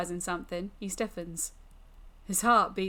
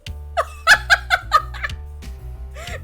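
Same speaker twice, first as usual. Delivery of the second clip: high, loud cackle; delighted fiendish laughter; demon's laugh; 9 dB louder than usual. Acted. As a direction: burst out in a shrieking laugh. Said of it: "When was it last that I laughed".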